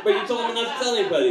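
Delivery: quick, high-pitched comeback; retold protest. Questioning his pastor's instruction, retold protest. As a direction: rush out the objection, voice up.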